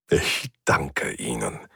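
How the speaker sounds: slow, low, halting thanks; choked-up gratitude, voice sinking; moved to tears. A narrator's reading. Old man thanks his helpers, moved to tears. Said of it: "Seine Stimme klang zu Tränen gerührt".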